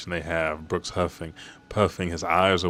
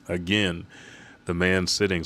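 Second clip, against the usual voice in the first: ordinary delivery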